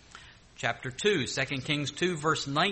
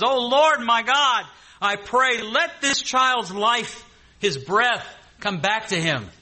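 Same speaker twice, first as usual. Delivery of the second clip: loud pleading prayer, the voice rising high; a prophet begging God aloud for a dead child's life, loud and pleading; fervent cry to God; 7 semitones higher, 5 dB louder, slower than usual. Acted. Elijah cries out to God.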